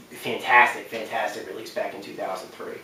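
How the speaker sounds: normal talking voice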